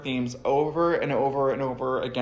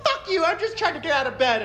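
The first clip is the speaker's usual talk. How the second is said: mock weary complainer; 13 semitones higher than usual. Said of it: "everyone was like".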